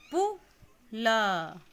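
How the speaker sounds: पुल drawn out slowly and loud, each sound clear; slow syllabic reading. Shown as a demonstration. Teacher reads पुल slowly, loud and stretched. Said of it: "अक्षर को मात्रा के साथ आप पढ़ेंगे".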